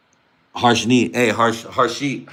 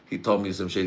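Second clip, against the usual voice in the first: ordinary delivery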